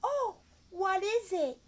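high, slow, wondering; sing-song kid-show teacher. Shown as a demonstration. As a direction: sound amazed for a small child. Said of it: "teacher performing ESL demo".

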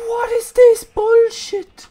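slow, very high, loud screech of disbelief; outraged yell. Real, not acted. Player outraged at the game, yelling. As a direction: yell each word out, high and drawn-out.

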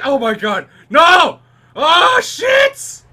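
loud, high-pitched gamer yelling; frantic panic. Real, not acted. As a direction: shout desperately as the attempt falls apart. Under fire, racing to turn valve.

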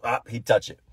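ordinary delivery

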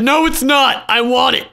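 loud, high demand; insist, raising the voice; typing a stubborn reply, read out loud and high; mock insistence. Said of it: "I said".

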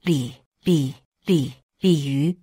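halting stutter on the name; stunned, broken-up stammer. A narrator's reading. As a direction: stumble over the first syllable repeatedly. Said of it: "磕磕绊绊地说".

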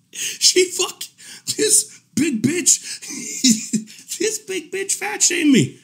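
high, squeaky laughing disbelief; laughing incredulity. Real, not acted. Telling viewers who fat shamed him, helpless incredulous laughter. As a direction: barely get it out through laughter.

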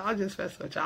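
usual speaking voice

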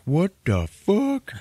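high, slow mock shock; stunned what the fuck. Characters staring at the horror, in high slow shock.

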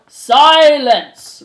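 huge, drawn-out high bellow; roared. Acted. Head mouse silences the angry crowd, roared.

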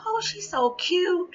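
put-on high, slow cooing over a baby; dad cooing. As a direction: coo it high and slow like a doting grandpa.

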